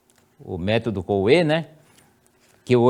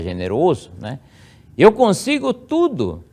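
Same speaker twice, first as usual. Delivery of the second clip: first-person affirmation; 5 semitones higher, 3 dB louder than usual. Shown as a demonstration. Child reciting the prayer to self, high and solemn. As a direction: proclaim it to yourself. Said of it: "você vai ler assim".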